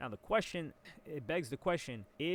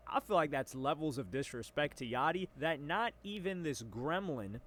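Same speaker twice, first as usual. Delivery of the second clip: normal talking voice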